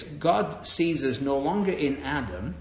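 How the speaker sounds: ordinary talk, nothing marked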